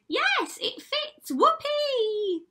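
very high, loud squealing sister; triumphant scream. A narrator's reading. Ugly sister claiming the prince in marriage, triumphant scream. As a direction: shriek with glee as the ugly sister. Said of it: "But she screamed".

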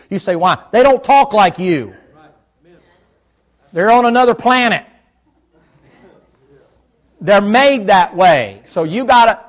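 loud, driving preacher's exhortation; preaching at full force. Acted. Preacher urging husbands to learn to talk, full-force preaching. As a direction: hammer it home to the men.

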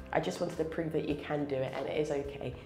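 ordinary delivery

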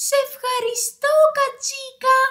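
high, singsong thank-you; grateful hedgehog character. Acted. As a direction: sing out thanks in a little hedgehog voice. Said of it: "είπε". (ψιλή, τραγουδιστή φωνή ευγνωμοσύνης)

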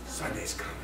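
soft whispered "Sunday's coming"; whispered refrain. Retold or imitated. Preacher whispering the Sunday refrain to the congregation. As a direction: let the hope drop to a whisper.

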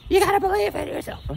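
very high squeaky imitation of her pleading; mocking her voice. Friend urging him to believe, very high mock voice. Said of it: "And she'll say".

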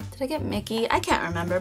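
ordinary talk, nothing marked

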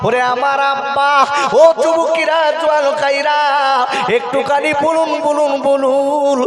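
chanted sermon storytelling; sing-song preacher's waz, full voice; rhythmic, stretched-out sermon chant; preaching. Acted.

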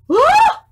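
high loud gasp and shriek; mock shriek of fright. Reader shrieks at the Gruffalo for children. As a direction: jump out with a startled scream.

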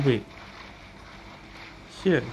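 usual speaking voice

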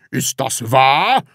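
loud, drawn-out question climbing at the end; indignant exclamation. A narrator's reading. Department head hears the complaint, indignant exclamation. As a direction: demand it slowly and loudly. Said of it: "rief der Abteilungsleiter".

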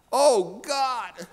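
high, loud cry for help; instinctive yell. Acted. Atheist fleeing a bear cries to God, alarmed yell. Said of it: "yelled out".

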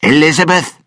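loud, harsh call into the dark; a villain's commanding yell; angry shout. Acted. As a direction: bark her name to flush her out. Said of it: "and shouted".

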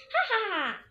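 high, loud ha-ha-ha; dwarf's acted laugh. A narrator's reading. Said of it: "the funny dwarf laughs".

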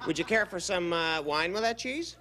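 high startled voice; nervously shooing away mice. Acted.

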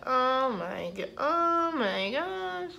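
slow, rising mouth-full delight; drawn-out delight. Real, not acted. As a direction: savor every word of pleasure. Tasting food, delighted.

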